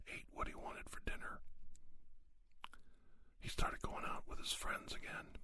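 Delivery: ordinary delivery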